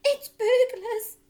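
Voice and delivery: high, loud, slow alarmed burst; mother panicking about burglars; mock hiss. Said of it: "hissed the mother".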